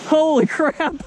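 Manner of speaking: high, stunned exclaiming; surprised exclaim. Real, not acted. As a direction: react in disbelief. Reacting to buddy's big fish.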